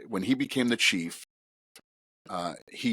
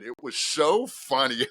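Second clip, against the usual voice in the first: normal talking voice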